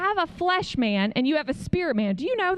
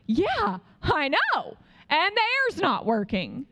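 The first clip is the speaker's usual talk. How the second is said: exasperated; 5 semitones higher, slower than usual. Retold or imitated. Retold exasperated answer on the phone.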